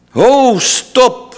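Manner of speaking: high, loud call to halt; conductor's shout. Acted. Conductor halts the rehearsal, shouting high and loud. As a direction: stop the whole orchestra with one cry. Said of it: "schreeuwde de dirigent".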